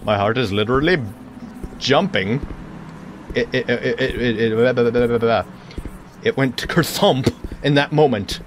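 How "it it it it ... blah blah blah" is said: stuttering. Real, not acted. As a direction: stammer through the words. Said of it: "without stuttering".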